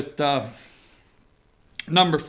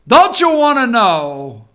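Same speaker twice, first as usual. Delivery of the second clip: teasing; 3 semitones higher, 7 dB louder than usual. Acted. Teasing the audience about paper.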